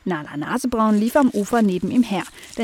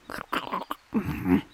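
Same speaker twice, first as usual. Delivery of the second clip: peeping bird voice; 3 semitones higher, 4 dB quieter, slower than usual. Acted. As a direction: chirp it like a tiny blackbird. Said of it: "piepste es da".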